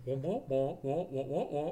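muffled muted-trumpet wah wah; vintage cartoon voice. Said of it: "he sounded like the character, the cartoon character goes".

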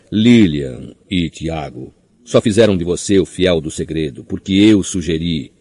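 ordinary delivery

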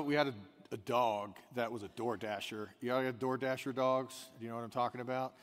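normal talking voice

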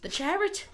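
"chariot" high and loud as "chair-ut"; loud, squeaky-high mangled "chariot"; exaggerated mispronunciation. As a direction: blow up the botched "chariot", shooting up in pitch. Echoing the joke mispronunciation.

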